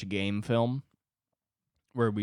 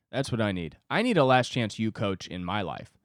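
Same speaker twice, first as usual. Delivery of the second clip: ordinary talk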